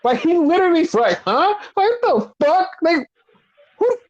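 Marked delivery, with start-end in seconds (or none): 1.2-4.0 very high, dumbfounded mock confusion; imitated speechless double-take; Seth Rollins. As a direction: act out total bafflement, pitch climbing. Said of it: "He's literally fucked up".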